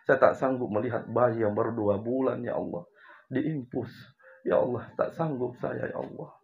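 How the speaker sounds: soft, broken plea of a mother; mother's tearful plea. Retold or imitated. Mother pleading to God for her sick baby, tearful and quiet. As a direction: beg God quietly through tears. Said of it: "Uminya menangis lalu berkata".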